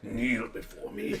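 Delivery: slow, low menacing demon voice; booming demon lord. Pretend. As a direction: command them to kneel. Wants to scare people.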